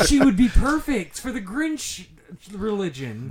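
big loud laughing blurt; loud giddy joke. Real, not acted. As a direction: burst out with it, cracking up.

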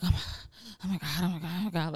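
quiet, repeated fretting under the breath; mock stressed-out murmur; stressed muttering. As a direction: imitate anxious self-talk, softly.

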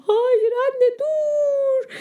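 panicked plea. Acted. Boy begging his mother not to water the seeds, near tears. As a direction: cry out to stop her, on the verge of tears.

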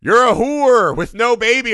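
a hammed-up demon jeering at the nun; demon taunt. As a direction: spit it out like a mocking demon. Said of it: "The demon just goes".

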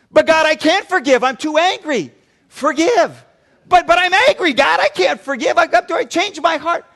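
high, loud, whining protest to God; whiny loud pleading. Retold or imitated. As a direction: beg God loudly like a stubborn child. Pleading believer in acted dialogue.

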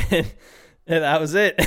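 can't keep the laugh in. Real, not acted. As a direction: crack up while saying it. Cracks up at own story.

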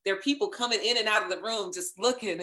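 normal talking voice

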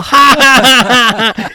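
high, loud hammed-up cackle; mock laughter. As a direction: laugh at the dad's expense. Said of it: "they're all".

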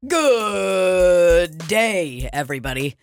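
announcer sponsor read; podcast ad voice. Acted.

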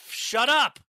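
very high, loud "shut up"; furious scream to silence a thought; holler. Acted. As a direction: explode at the voice in your head. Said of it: "I hollered".

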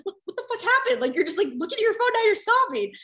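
incredulous mock reaction, half laughing; baffled partner. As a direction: act a boyfriend thrown by sudden tears. Partner reacting to her crying.

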